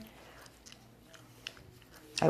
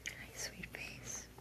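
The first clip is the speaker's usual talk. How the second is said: slow, soft whisper much softer; tender whisper; 2 semitones lower, 20 dB quieter, slower than usual. Real, not acted. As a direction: whisper sweetly close to the dog. Whispering to the dog.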